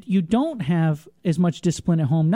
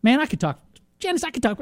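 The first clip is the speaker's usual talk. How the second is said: ordinary talk, nothing marked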